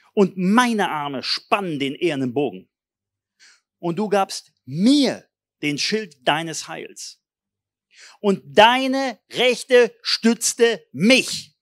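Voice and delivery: reading the psalm of victory aloud to the church; solemn recitation. Shown as a demonstration. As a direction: recite the psalm with mounting force.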